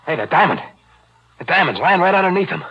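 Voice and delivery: can't believe his luck; excited discovery. Acted. Killer spots the diamond, excited and loud. Finds the diamond under bones.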